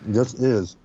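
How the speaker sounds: ordinary talk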